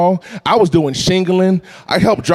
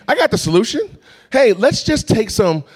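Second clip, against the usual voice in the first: put-on eager eight-year-old; 7 semitones higher than usual. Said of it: "Now, I'm eight years old".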